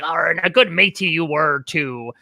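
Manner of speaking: lightly imitated pirate talk. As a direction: do a mild mock pirate for a quote. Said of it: "he went into a pirate voice".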